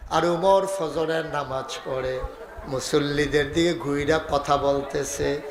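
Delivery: ordinary talk, nothing marked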